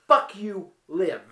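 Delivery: loud furious "Fuck you, live"; angry shout. Retold or imitated. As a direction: hurl it at him as you throw the will. Paddy throws the will at Bob.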